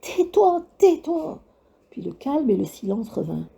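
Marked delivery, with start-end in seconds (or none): 0.0-1.4 high repeated shriek; screaming. A narrator's reading. Desperate boy shrieks at the laughing witch. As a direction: yell it over and over. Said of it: "il hurla encore et encore".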